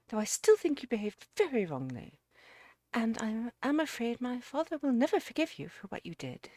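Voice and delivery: put-on reproachful young lady. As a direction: scold him primly, in character. Narrator voices Miss Derrick's reproach.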